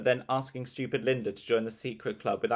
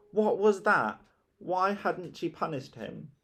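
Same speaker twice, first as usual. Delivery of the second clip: outraged disbelief; 8 semitones higher than usual. Acted. Peter outraged.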